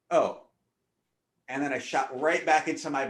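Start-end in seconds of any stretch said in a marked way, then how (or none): none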